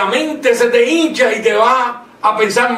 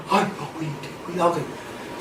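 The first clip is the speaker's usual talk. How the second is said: much softer, fearful hush; mock reverent hush, much softer; hushed awe; 3 semitones lower, 10 dB quieter than usual. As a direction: hush it like something sacred is happening. Imitating an awed, hushed worshipper.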